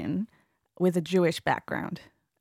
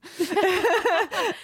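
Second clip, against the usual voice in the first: high laugh; 12 semitones higher than usual. Real, not acted. Laughing at herself.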